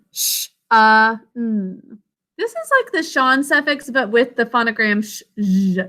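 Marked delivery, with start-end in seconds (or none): none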